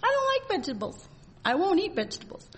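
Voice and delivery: put-on high, fast pouting child voice; whiny little child. Preacher mimics a little child refusing vegetables, high and pouty. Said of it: "Children, little children?".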